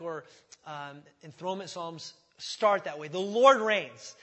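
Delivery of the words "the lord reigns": loud, slow scripture declaration; solemn proclamation. Acted. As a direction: proclaim it to the whole room, slowly. Preacher repeats the declaration.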